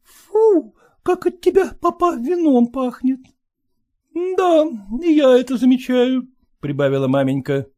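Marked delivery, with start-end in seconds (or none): 0.0-3.2 put-on high, loud girlish voice; young daughter. Daughter chiding father. 4.4-6.3 high, reproachful wife; scolding wife. Acted.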